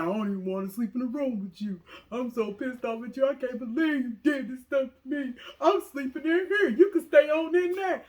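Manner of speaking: hammed-up angry partner, laughing by the end; mocking angry boyfriend. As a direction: act out the mad partner, building to a laughing whine. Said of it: "He's like".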